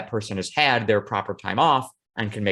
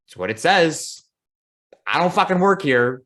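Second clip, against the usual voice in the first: exasperated retort; 5 semitones higher, 4 dB louder than usual. Retold or imitated. Annoyed reply to travellers.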